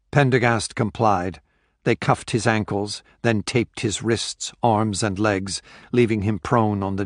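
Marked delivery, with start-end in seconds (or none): none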